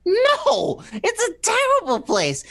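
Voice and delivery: vehement outburst. Acted. Outburst about New York.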